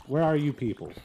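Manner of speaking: exasperated yell at unseen mobs; frustrated call-out. Real, not acted. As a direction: shout for the hiding mobs to show up. Yelling at hidden mobs in game.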